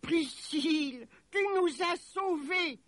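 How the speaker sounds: high, drawn-out cry of relief; elated, high-pitched mother; joyful exclamation. A narrator's reading. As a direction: cry out in wonder at being saved. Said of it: "s'écria-t-elle".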